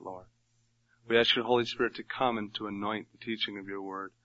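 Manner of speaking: ordinary talk, nothing marked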